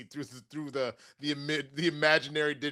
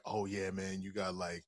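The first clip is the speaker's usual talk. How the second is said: low, sheepish mumble; awkward hushed asking; 9 semitones lower, 8 dB quieter than usual. Retold or imitated. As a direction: ask it under your breath, embarrassed.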